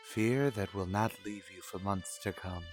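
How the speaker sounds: usual speaking voice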